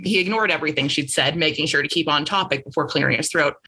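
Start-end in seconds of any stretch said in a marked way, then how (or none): none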